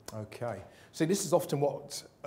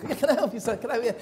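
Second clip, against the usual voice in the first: eager waitress; 7 semitones higher, faster than usual. Retold or imitated. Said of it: "she was over like".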